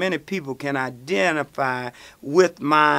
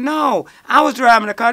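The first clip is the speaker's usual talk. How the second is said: higher, louder protest; calling out; 7 semitones higher, 6 dB louder than usual. Retold or imitated. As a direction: shout it from the doorway. Andre defends him to police.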